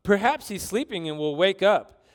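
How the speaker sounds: lively, acted mockery; mocking taunt. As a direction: taunt them with exaggerated helpful suggestions. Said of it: "Elijah mocked them".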